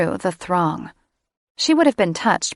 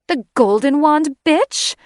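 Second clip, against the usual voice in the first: venomous; 9 semitones higher, 6 dB louder than usual. Acted. Catherine insulting Sarah, venomous and spat. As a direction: spit it out, much higher and louder. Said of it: "spat the words like they were made of venom".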